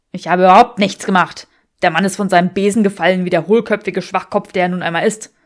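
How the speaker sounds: loud, fast, scornful denial; man brushing off the blame; angry Draco. Acted. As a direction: sneer the insult out loud. Draco defends himself angrily.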